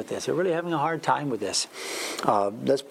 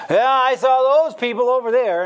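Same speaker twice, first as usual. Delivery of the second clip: a loud, higher, smug show of piety, pointing at others; mock Pharisee; 7 semitones higher, 6 dB louder than usual. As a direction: play the proud hypocrite judging the room. Mocking a self-righteous Pharisee.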